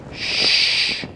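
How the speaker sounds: long drawn-out hush; soft, drawn "shh" for silence; shushing. Acted. Saint Peter to the man in heaven, shushing. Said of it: "I need you to be really quiet".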